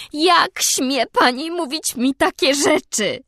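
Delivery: high, slow, seething repeat; vehement. A narrator's reading. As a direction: spit each word slowly. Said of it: "powtórzyła gwałtownie".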